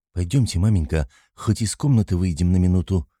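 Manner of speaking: usual speaking voice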